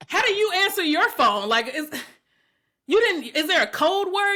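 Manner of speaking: high-pitched, baffled protest; exasperated questioning. Retold or imitated. Woman mock-questioning her date about phone greetings, exasperated. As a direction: demand answers in comic disbelief.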